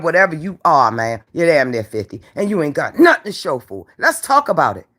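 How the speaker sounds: normal talking voice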